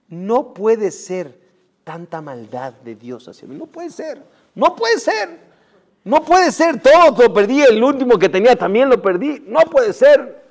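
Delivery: loud, high, raging cry against God; furious lament. Acted. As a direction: rage at losing everything. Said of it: "la rabia que tenía, estaba enojado".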